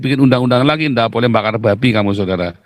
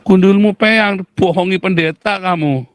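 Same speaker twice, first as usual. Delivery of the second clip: mock outburst; 6 semitones higher than usual. Real, not acted. Mock insult, higher and louder.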